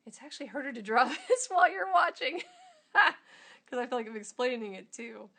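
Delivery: real laughter while explaining; laughing. Real, not acted. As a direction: giggle at the clumsy drawing. Laughing at drawing on camera.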